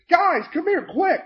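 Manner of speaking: high, urgent call for help; urgent yell. Retold or imitated. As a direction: call the others over in a hurry. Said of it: "then Riff yells for us".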